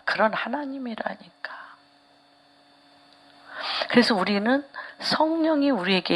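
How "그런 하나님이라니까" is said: breathy hushed aside to the congregation; whisper. Real, not acted. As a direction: drop to a whisper as if sharing a secret. Preacher drops to aside. (속삭이듯)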